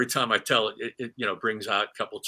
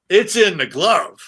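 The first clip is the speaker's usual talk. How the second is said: amazed; 4 semitones higher, 9 dB louder than usual. Retold or imitated. Retold amazement at fastball.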